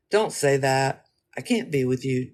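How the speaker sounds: ordinary delivery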